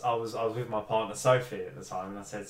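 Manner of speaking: ordinary talk, nothing marked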